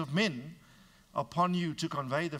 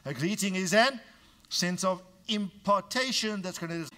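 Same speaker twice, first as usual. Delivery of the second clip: normal talking voice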